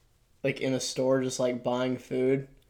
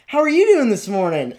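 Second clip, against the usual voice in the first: high, loud, overly cheery greeting; mock a bubbly cashier chirping hello; chirpy cashier greeting; 8 semitones higher, 9 dB louder than usual. Cashier chirping a greeting at a tired customer, overly cheery.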